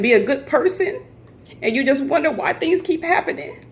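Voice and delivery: real crying voice pitched very high; tearful, emotional. Real, not acted. Vlogger upset over water damage, tearful. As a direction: choke it out high through tears. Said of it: "I'm sorry for getting emotional".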